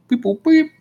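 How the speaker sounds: high squeaky beep boop; imitated computer beeps. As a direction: chirp it like a machine. Robot making computer beeps.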